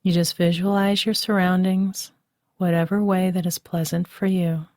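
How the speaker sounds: normal talking voice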